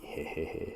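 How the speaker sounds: breathy sneaky heh heh; sly unvoiced chuckle. Real, not acted. Snickering after teasing the viewers.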